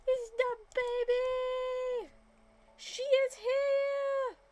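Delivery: very high, very loud shriek of joy; ecstatic squeal. Real, not acted. Announcing the new baby girl to viewers, ecstatic shriek.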